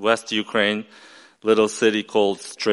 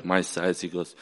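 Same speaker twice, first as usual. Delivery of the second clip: ordinary talk, nothing marked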